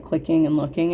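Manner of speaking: normal talking voice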